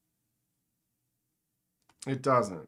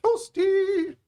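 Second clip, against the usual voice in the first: very high sung-out falsetto; game catchphrase; 4 dB louder than usual. Acted. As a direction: pipe it up high like a video-game taunt. Mimics Mortal Kombat catchphrase.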